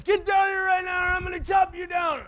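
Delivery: high, loud acted yell; angry shouted threat. Bully with an axe threatens the boys, angry shout. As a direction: bellow it up at them as a furious kid.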